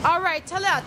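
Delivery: normal talking voice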